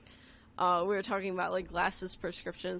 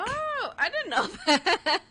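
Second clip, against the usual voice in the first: higher, louder, breaking into a laugh; laughing surprise; 10 semitones higher, 4 dB louder than usual. Real, not acted. Friend learning about eyesight scores, laughing in surprise. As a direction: laugh at your own ignorance.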